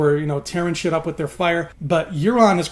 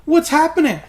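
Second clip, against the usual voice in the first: panicked yell; 9 semitones higher, 5 dB louder than usual. Retold or imitated. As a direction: cry out in disbelief. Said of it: "He's like".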